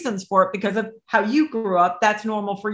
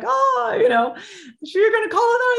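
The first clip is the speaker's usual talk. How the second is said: surprised; 14 semitones higher than usual. Retold or imitated. Bella hearing the business is named for her, surprised high voice.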